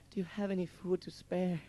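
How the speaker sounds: a lightly voiced pleading old beggar; high pleading beggar. Bag lady begging the guys for food, high pleading voice. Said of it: "and she says".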